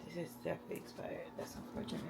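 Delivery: fast voiceless whisper, half to yourself; whispering to herself. Real, not acted. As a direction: mutter the verdict under your breath, quickly. Finds expired food, muttering.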